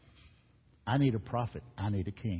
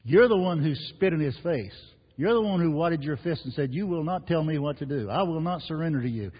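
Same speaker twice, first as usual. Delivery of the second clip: higher and louder, defiant; preaching at full force; 5 semitones higher, 6 dB louder than usual. Acted. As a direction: throw the sinner's defiance at them. Preacher dramatizing the sinner's defiance.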